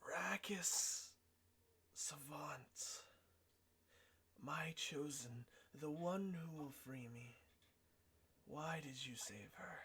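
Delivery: unvoiced rasping entity whisper; raspy whisper voice. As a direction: hiss it like a hidden presence. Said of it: "a raspy voice".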